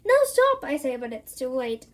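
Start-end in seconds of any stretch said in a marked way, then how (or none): 0.0-0.6 high, loud cry of alarm; panicked shout. Acted. Narrator shouting to stop the kid's wish, high and loud. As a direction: yell to stop it before it's too late.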